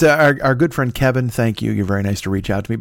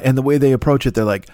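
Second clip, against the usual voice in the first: normal talking voice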